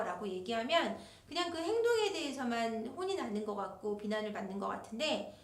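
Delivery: usual speaking voice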